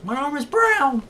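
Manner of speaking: hammed-up shock at his new arm; indignant whine. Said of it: "And he goes".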